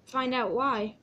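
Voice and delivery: ordinary delivery, nothing marked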